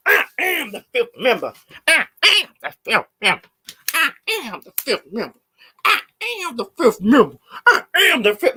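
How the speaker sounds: high, singsong mocking repeat; mocking mimic. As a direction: parrot her in a high mocking voice. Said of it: "she kept on saying".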